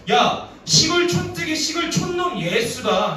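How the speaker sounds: loud, high, drawn-out jeer; mocking gossip. Gossips sneering about a village bumpkin, mocking. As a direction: call out scornfully, stretching the words.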